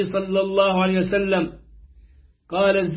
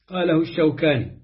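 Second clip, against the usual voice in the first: normal talking voice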